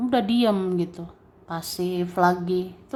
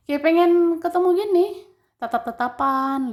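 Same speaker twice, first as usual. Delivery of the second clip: higher, louder and quicker, eager; excited longing; 9 semitones higher, 4 dB louder than usual. Pretend. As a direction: burst out with the wish to meet. Longing to meet someone.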